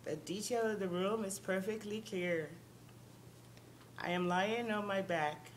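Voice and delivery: ordinary talk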